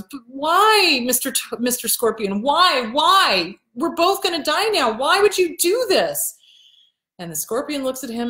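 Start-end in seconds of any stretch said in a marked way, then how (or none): 0.0-6.4 acted dismay. Drowning toad to the scorpion who stung him, hammed-up high dismay. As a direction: wail it as the betrayed toad. Looks at him with these wide eyes.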